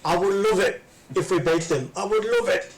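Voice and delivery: impassioned rant line, pitch leaping up at the end; football manager's emotional outburst; Kevin Keegan. Acted. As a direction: quote it with feeling, climbing high on the last repeat. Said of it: "that Kevin Keegan line".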